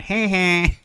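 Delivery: a hint of a mocking schoolyard bully; Simpsons bully. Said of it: "that cartoon from The Simpsons, the bully going".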